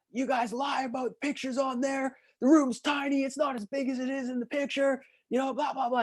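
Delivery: high-pitched mock complaint; angry rant. Guest ranting about tiny room, mock angry. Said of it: "he's yelling".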